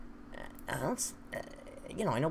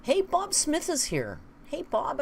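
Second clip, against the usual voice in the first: bright greeting; 11 semitones higher than usual. Real, not acted. Greeting a viewer in chat.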